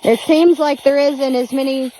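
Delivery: ordinary talk, nothing marked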